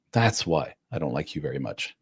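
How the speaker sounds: ordinary talk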